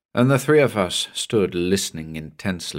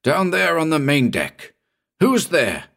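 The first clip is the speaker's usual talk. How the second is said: shouted; 9 semitones higher than usual. A narrator's reading. Captain repeating his call into the fog, higher shout. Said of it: "shouted the captain abruptly".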